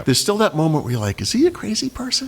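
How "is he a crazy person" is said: high, hushed wondering; wary inner voice. Retold or imitated. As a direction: ask yourself warily. Inner thought, quoted.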